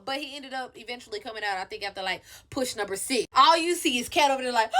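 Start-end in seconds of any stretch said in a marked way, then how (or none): none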